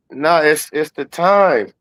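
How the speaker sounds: ordinary delivery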